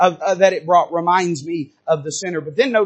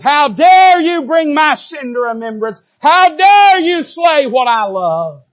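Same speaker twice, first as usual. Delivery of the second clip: 1 defiant shout; 8 semitones higher, 6 dB louder, slower than usual. Pretend. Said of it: "He shakes his fist at God".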